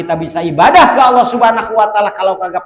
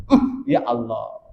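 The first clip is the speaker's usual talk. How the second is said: awed prayer; 7 semitones lower, 6 dB quieter than usual. Acted. Prophet sighs in awe.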